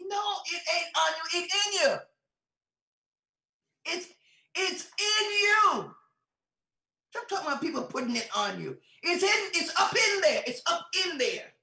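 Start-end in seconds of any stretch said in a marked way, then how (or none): 0.1-5.9 loud, high, drawn-out preacher's cry; full-force preaching rebuttal. Real, not acted. 9.0-11.5 loud, high, insistent repetition; emphatic preaching shout. Real, not acted. Preacher insists at full force.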